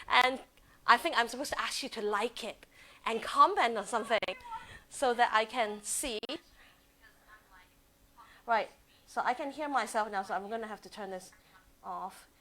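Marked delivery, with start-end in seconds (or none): none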